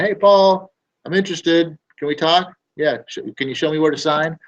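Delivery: high, eager voice of a buyer ready to sign; eager lead. Retold or imitated. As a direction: sound ready to sign right now. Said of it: "the ones that are going".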